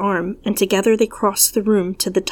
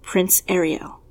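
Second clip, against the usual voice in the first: ordinary delivery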